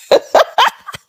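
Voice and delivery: high burst of laughter. Real, not acted. Laughing to her audience about ditching his calls. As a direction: laugh it off gleefully.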